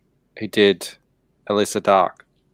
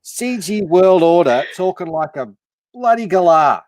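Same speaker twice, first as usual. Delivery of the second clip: mock Australian accent. Friend teasing the Americans on stream in a mock Australian accent. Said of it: "destroying our language".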